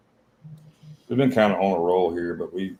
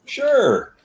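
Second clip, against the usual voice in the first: much higher, falsetto-style mocking "sure"; mock flaky friend; 4 dB louder than usual. Mocking a friend who bails on the call, in a high squeaky voice. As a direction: squeak it like a flaky buddy blowing you off. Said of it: "What do you think about somebody like".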